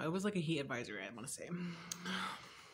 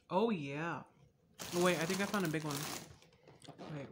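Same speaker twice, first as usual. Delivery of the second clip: ordinary talk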